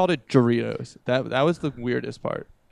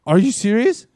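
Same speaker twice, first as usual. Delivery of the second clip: incredulous; 9 semitones higher, 7 dB louder than usual. Retold or imitated. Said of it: "She's like".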